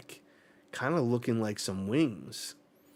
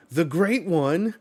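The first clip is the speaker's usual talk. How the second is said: higher and louder, excited outburst; awed exclamation; 7 semitones higher, 8 dB louder than usual. Acted. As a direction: call it out like you've seen a legend arrive. Reading a character's awed cry.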